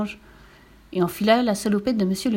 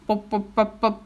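evenly popped motor noises; mouth-made putt-putt of a motor; imitated engine sputter. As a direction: sputter like an old engine starting. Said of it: "fit le moteur".

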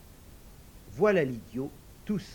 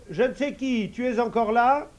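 higher, louder unsure call; hesitant idiot calling; 9 semitones higher, 8 dB louder than usual. Acted. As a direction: try the magic call timidly. Idiot asks.

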